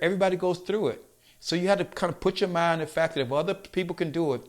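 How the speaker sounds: normal talking voice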